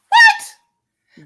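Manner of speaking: high, loud shocked what. Retold or imitated. Hearing about the Christmas money, shocked cry. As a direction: gasp it out in disbelief.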